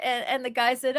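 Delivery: ordinary talk, nothing marked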